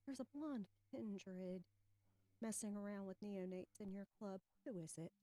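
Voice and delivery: hushed and secretive, meant for one ear; whispering in ear. Acted. Player leans to Bishop's ear about a stranger, hushed whisper. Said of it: "whisper in his ear".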